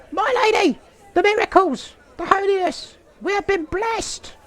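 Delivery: very high falsetto peasant woman; squeaky Monty Python lady, breathless excitement. Acted. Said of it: "how women speak is built off of watching Monty Python".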